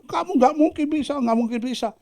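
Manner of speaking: high mocking frog chant; high, taunting voice of doubters. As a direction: jeer that he can't make it. Frogs taunting.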